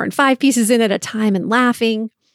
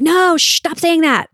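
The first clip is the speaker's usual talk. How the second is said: high-pitched playful protest; mock alarm; 7 semitones higher than usual. Mom hushing her giggling daughter, mock alarm. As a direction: pretend to be scandalized and hush her.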